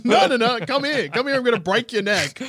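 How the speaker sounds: barbarian. Pretend. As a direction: call him back like a rampaging warrior. Chasing the villain, mock threat.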